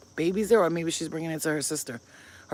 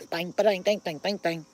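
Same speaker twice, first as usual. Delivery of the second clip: mock gunshots. Mimics gunshots after dog's boast.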